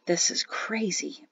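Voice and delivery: slow, soft murmur under the breath. A narrator's reading. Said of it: "Perrin murmured".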